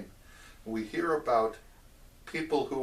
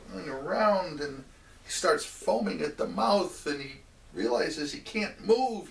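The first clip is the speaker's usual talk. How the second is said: ordinary talk, nothing marked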